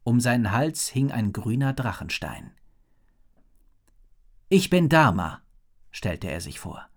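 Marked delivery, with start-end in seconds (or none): 4.5-5.4 put-on friendly boy. A narrator's reading. Boy introduces himself.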